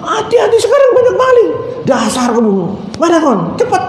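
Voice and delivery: thief scolds the guard, bossy and quick; scolding. Retold or imitated. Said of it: "Malah marah-marah". (omelan tinggi dan keras)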